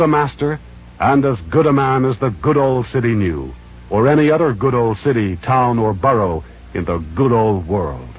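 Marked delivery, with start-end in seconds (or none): none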